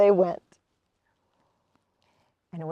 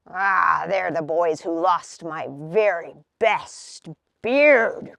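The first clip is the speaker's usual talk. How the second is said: lower, slow troll growl; 7 semitones lower, 3 dB louder, slower than usual. A narrator's reading. Troll complains to Hazel about his beard, low growl. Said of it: "growled the troll".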